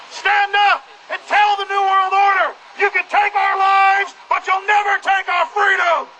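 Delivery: shouted call to stand up and resist; rallying cry. Real, not acted. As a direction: end the speech on a defiant battle cry. Climax of a rallying speech.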